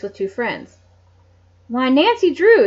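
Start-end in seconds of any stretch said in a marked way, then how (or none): 1.7-2.6 higher, louder happy surprise; exclaimed. Acted. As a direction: greet an old friend in delight. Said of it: "exclaimed Jean Mast".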